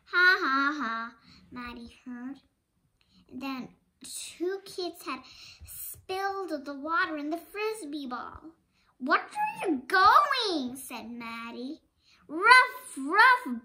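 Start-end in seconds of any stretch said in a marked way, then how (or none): 0.1-1.1 loud acted ha ha ha; mock laugh. Other kids laughing at Maddie, a loud mock laugh. 9.0-10.7 higher, louder, slow call; calling after. Acted. Maddie calling after the running dog, high and loud. 12.3-13.6 loud high barking ruff ruff; dog bark. Acted. The dog barking at Maddie, loud and high. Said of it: "barked the dog".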